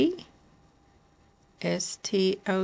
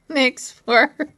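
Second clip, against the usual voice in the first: laughing; 5 semitones higher, 5 dB louder than usual. Real, not acted. Laughing while spelling letter board.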